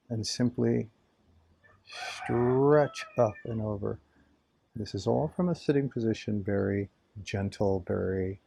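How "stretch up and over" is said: slow, elongated word mimicking the stretch; long, drawn exercise cue. Real, not acted. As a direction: stretch the word out as the body reaches.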